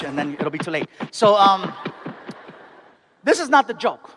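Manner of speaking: ordinary delivery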